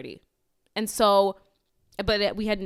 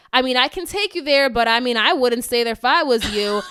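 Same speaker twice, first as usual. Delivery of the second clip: louder, matter-of-fact driver's warning; imitating the Uber driver; 2 semitones higher, 9 dB louder than usual. Uber driver warning the stranded family, loud and blunt. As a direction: play the driver bluntly advising against it.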